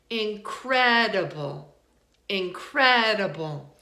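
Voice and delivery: slow "incredible" with a stretched CRED; drawn-out in-CRED-uh-bull for "incredible"; second-syllable stress. Shown as a demonstration. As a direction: lean hard on CRED in "incredible". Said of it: "we stress the second one".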